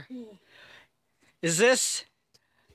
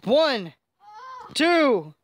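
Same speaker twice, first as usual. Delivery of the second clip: excited commentator; 6 semitones higher than usual. Pretend. Commentator building to the pin.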